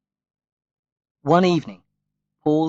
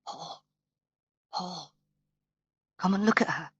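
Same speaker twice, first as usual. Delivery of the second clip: hushed, urgent call to her brother; softer, a hint of a whisper at night; 7 semitones higher, 6 dB quieter than usual. A narrator's reading. Sister waking Paul in the night, hushed and urgent. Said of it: "Annie's whispered".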